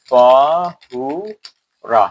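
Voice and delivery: তহুরা slowly stretched; recited তহুরা held long; drawn-out recitation. Shown as a demonstration. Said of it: "সংক্ষেপে মুখস্থ করে ফেলবে".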